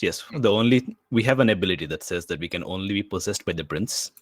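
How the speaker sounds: normal talking voice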